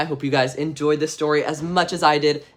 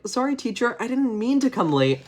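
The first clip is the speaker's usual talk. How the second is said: put-on higher apologetic kid voice; sheepish kid; 8 semitones higher than usual. David apologizes to his teacher in a high kid voice. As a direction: squeak out an excuse. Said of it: "he's like".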